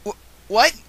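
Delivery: high, loud, shaken whimper of disbelief. Acted. Filly hearing bad news, whimpering in shock. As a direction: gasp it out, stunned and scared. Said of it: "I whimper".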